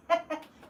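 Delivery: short, high, loud laugh. Real, not acted. Streamer laughs about having no chat, short loud laugh. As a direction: let out a quick loud chuckle.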